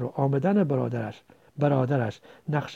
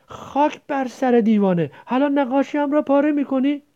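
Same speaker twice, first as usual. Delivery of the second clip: higher-pitched angry outburst; indignant scolding; 10 semitones higher, 4 dB louder than usual. Acted. Scolding the one who tore the drawing, indignant. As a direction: scold them in outrage. Quarrel over the torn drawing.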